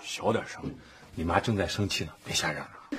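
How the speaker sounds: low, soft shushing; hushed warning. Acted. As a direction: shush the child and keep it down. (压低声音提醒)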